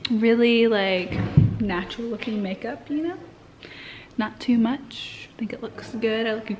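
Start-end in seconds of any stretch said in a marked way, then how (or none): 1.6-6.5 whisper. Real, not acted. Vlogger confiding about her makeup, whispered. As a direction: hush it like a confidence.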